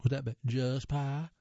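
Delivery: drawled Southern "just pie"; Southern accent. Shown as a demonstration. Reader tries out how chess pie was misheard, Southern accent. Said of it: "said in a southern accent".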